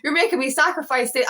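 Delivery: mock-indignant complaint to the universe; angry at the universe. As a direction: re-enact an angry demand for an explanation. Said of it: "so angry with the universe".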